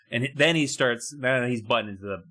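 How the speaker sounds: ordinary delivery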